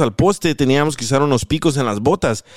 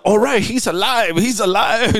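mock American firefighter; 5 semitones higher, slower than usual.

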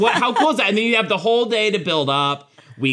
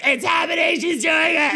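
shrieking fan tweet read aloud; excited shout; 12 semitones higher than usual. Retold or imitated. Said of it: "be like".

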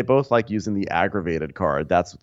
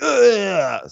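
mock groan; 12 semitones higher, 5 dB louder than usual. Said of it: "he's like".

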